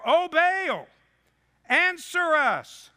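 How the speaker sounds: high, loud desperate plea; pleading cry to Baal. Acted. Preacher voicing Baal's prophets begging their god. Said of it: "listen to their prayer".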